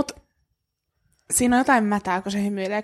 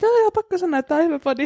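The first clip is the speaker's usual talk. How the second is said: lightly voiced higher-pitched listener; imagined fan praise; 6 semitones higher, faster than usual. Imagining a celebrity listener praising the podcast, lightly acted approval. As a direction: play an approving listener, voice raised.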